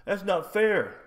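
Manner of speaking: high-pitched whiny whimper; mock crying complaint. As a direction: mockingly imitate a crybaby protesting. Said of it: "they'll cry about it and say".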